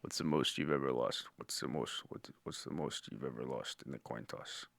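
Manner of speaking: low, soft, menacing whisper of a hitman; Anton Chigurh impression. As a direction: hush it down low and deadly. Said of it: "Respond like Anton Chigurh".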